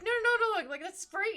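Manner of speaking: embarrassed classmate. Retold or imitated. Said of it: "She's like".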